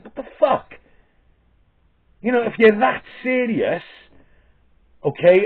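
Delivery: rising angry rant; heated rant. Real, not acted. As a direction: vent the anger, pitch up. Angry rant about pandemic.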